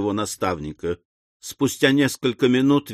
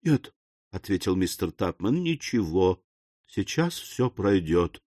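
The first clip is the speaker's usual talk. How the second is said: soft, weak, fading reassurance; faint invalid; 4 semitones lower, 6 dB quieter than usual. A narrator's reading. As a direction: answer feebly, as if about to swoon. Feigns faintness on the sofa.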